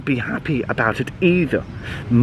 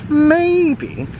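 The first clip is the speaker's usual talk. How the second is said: high squeak; 13 semitones higher, 3 dB louder than usual. Acted.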